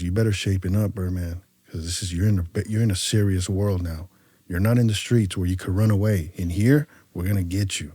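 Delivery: low, controlled cautioning voice; retold quiet talking-to; stern warning. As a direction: warn him gravely without raising your voice.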